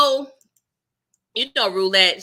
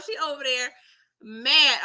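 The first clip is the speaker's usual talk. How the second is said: ordinary talk, nothing marked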